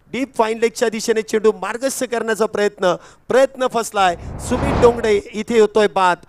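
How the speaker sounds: high, loud wicket call; live play-by-play. Acted. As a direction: call the catch for the crowd, voice lifted. (उत्साही cricket समालोचन)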